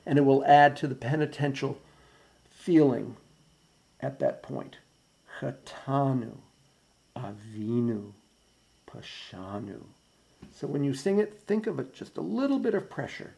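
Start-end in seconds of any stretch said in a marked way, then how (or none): 5.4-9.8 Chatanu, Avinu, Pashanu each with a light push on the middle syllable, slow and soft; stress on second syllables. Shown as a demonstration. Choir director to altos, Chatanu, Avinu, Pashanu with soft middle-syllable stress. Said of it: "just a little stress on the second syllable".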